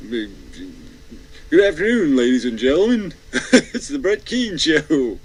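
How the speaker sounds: high, loud, laughing showman intro; Brett Keane. Retold or imitated. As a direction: ham up a pompous host's greeting. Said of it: "his whole personality is just".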